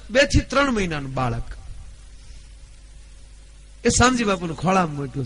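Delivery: ordinary delivery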